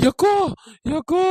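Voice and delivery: short, wailed calls for big sister; wailing. Acted. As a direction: wail the call as if terrified. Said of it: "எதுக்கு ஒப்பாரி வச்சிட்டு இருக்கா". (ஒப்பாரி வச்சு அழுறது)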